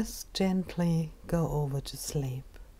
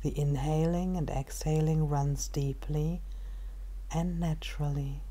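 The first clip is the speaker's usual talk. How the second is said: calm, unhurried meditation guide with long pauses; measured body-scan narration, phrase by phrase; guided meditation; 7 dB quieter, slower than usual. Acted. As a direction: ease the listener into stillness, softer than everyday talk.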